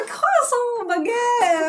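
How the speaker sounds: put-on high, loud French-accented shriek; screamed mock French. Actress screaming at an imagined vampire, high mock French scream. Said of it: "she's, like, screaming over".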